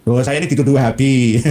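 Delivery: ordinary talk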